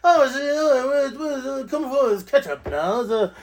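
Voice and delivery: playful mock English accent; imitated English accent. Poking fun at how English colleagues talk, mock English accent. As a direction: imitate English coworkers' pronunciations. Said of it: "Like, the way they talk, it's hilarious".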